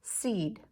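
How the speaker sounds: seed said quickly as one word; shrunk word. Shown as a demonstration. Teacher shrinks seed back for class, quick single word. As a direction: snap seed back together. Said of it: "stretch and shrink".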